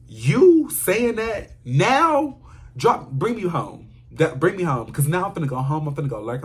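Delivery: high, loud, stretched disbelief then a firm demand; indignant outburst. Retold or imitated. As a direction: demand to be taken home. Acted angry demand to go home.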